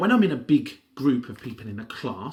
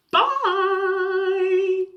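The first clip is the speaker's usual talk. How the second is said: high, bright sign-off shout to the viewers; cheery high bye; 6 dB louder than usual. Acted. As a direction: wave goodbye to the kids, loud and high.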